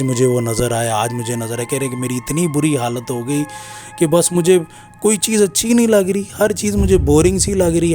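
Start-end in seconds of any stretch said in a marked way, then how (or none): none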